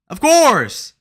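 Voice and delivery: high, loud outburst; emphatic shout. Acted. Streamer repeating his agreement, shouting it out. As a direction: holler it, drawing out the last word.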